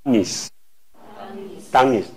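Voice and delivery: Nis，Dangis said very slow and soft; drawn-out Dangis. Shown as a demonstration. Teacher models the Tagalog word for students, very slowly. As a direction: stretch out Nis，Dangis syllable by syllable. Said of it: "塔卡罗语呢".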